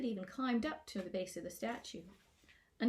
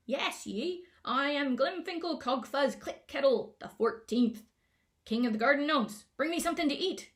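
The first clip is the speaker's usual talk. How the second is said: bossy gnome shouting; 6 semitones higher, 9 dB louder than usual. A narrator's reading. Gnome king ordering Twig to fetch food, loud bossy shout. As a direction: demand it like a spoiled toddler king. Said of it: "His shouting reminded Twig".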